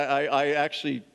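ordinary talk, nothing marked

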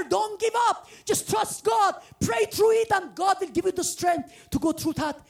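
rally the congregation, pushing each line; preaching at full force. Acted.

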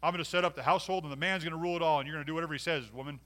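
fast, loud bossy husband parody; mock domineering husband. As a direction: bark the orders like a tyrant husband, hammed up. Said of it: "here's what the Bible does not say".

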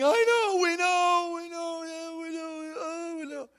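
high, wailing imitation of crying; hammed-up high sobbing plea; mock weeping. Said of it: "they're weeping, I'm weeping".

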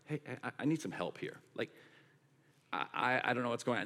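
soft, low, halting request for help; hushed, hesitant mock plea for help; reluctant admission. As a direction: barely get the admission out. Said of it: "doesn't want to admit".

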